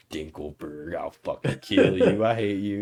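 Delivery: low, slow muttered threat; 1 low grudging rage. Retold or imitated. Timmy's dad cursing the neighbor, low growled threat. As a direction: grumble it down low with hatred. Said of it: "He's like".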